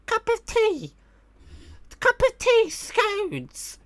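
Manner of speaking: very high, squeaky fake-English voice; mock English accent. Streamer mimicking Americans trying to sound English, squeaky mock accent. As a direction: caricature an American's bad English accent in falsetto. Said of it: "If an American tries to sound English".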